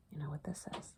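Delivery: secret whispered question; hushed teacher whisper. Real, not acted. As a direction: lean in and whisper it. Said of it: "teacher whispers to child".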